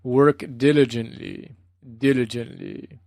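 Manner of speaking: rhythmic, measured Burmese-accent impression; Goenka's rhythmic Burmese voice. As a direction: imitate a Burmese teacher, drawing out each word. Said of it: "Goenka's rhythmic Burmese voice".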